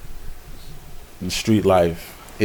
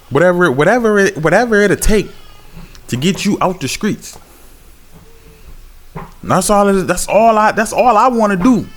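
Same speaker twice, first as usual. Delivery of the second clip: fervent street sermon, higher pitch; preaching; 9 semitones higher than usual. Acted. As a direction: preach it out to the block.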